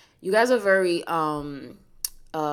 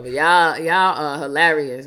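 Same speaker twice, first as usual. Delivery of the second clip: louder, teasing amusement; loud amused; 8 dB louder than usual. Real, not acted. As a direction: tease them loudly.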